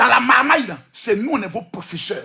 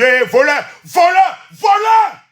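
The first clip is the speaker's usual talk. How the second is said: escalating shouts of voleur, each higher and louder; crying thief; 5 semitones higher, 6 dB louder, slower than usual. Retold or imitated. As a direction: yell voleur to raise the alarm. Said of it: "on dit".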